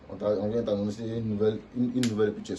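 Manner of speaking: ordinary talk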